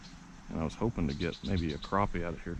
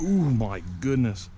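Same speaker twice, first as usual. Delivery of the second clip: excited; 3 semitones higher, 6 dB louder, slower than usual. Real, not acted. Big fish strikes.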